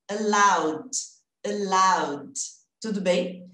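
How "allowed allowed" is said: "Allowed" in two syllables, stressed "laud" with light d; correct -ed ending. Shown as a demonstration. Teacher modelling past tense for students, light final D. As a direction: close "allowed" on a bare d, no E. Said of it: "acrescentar só o som do D".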